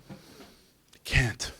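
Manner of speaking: breathy whisper of giving up; a hopeless whisper to yourself; whispered despair. Retold or imitated. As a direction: whisper it as if defeated.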